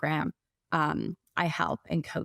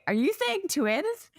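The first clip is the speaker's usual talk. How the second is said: higher, slower, a retold gasp of disbelief; stunned realization; 11 semitones higher, slower than usual. Mother retelling the ultrasound, stunned and disbelieving. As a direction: relive the shock of hearing it's twins.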